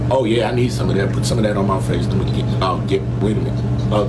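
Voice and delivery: very low dubbed animal voice; deep cartoon pet voice.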